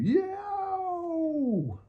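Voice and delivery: very high cartoon yelp; a high comic yowl; yelp of pain. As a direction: squeak it out as you fly through glass. Bradley blown out window.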